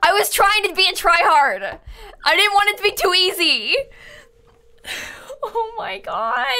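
high, whiny lament; wailing regret. Real, not acted. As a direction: bemoan your mistake in a high voice. Lamenting the death in game.

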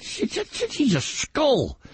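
stammering in disbelief, slowed; disbelieving he's a skull; incredulous. Retold or imitated. Incredulous reply in story.

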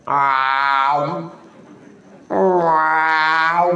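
long, drawn-out cat yowl; drawn-out meow. Retold or imitated. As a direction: imitate a yowling cat. Imitates the cat crying out.

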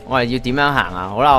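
ordinary delivery

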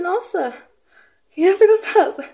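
high, loud mock amazement; mock amazed. Admiring herself in the mirror, loud mock wonder. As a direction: play it like she can't believe it. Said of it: "olhei assim pra mim e falei".

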